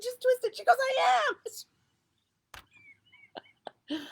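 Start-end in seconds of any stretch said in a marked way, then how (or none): 0.0-0.6 urging. Retold or imitated. Telling friend how to twist. 0.8-4.1 much higher, louder frustrated cry; mock frustrated shout; exasperated yell. Friend's exasperated reply retold.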